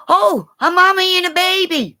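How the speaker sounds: reenacted high-pitched gushing; excited squeal. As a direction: squeal it like spotting something adorable. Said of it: "I'm going".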